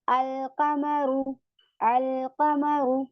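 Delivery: अलकमरु very slow, with the l of अल clearly sounded; clear lam. Shown as a demonstration. Teacher shows the students a moon letter. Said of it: "मैंने यहाँ पे अल को पढ़ा".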